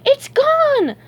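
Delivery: very high, loud wail of panic; shrieked dismay; horrified cry. A narrator's reading. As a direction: wail it in horror. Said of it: "he cried, horrified".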